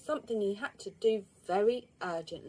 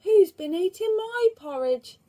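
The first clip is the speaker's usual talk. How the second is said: higher, loud medium bear asking; the medium bear asking about the porridge; 10 semitones higher, 9 dB louder than usual. A narrator's reading. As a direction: ask it as the middle bear. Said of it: "asked the medium voice".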